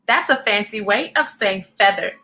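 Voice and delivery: lively storybook read-aloud; reading to children. A narrator's reading. Reading a picture book aloud to young viewers, lively.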